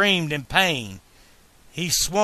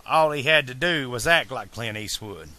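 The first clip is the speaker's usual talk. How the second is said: ordinary delivery, nothing marked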